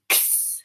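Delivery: X as a voiceless ks, not the letter name; isolated final sound. Shown as a demonstration. Teacher isolates the end of box, X as ks. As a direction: say X as k running into sss. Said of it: "is the final sound in box".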